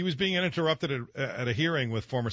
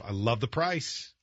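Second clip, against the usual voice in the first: ordinary talk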